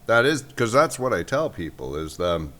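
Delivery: talking through a mouthful of bread; chewing while speaking; mouth full. Real, not acted. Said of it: "you got me talking with my mouth full".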